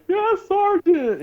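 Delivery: high, drawn-out squeak; mock cowed reply. Trainee answering the sergeant, high mock-cowed voice. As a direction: answer meekly in a strained high voice. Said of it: "And I was like".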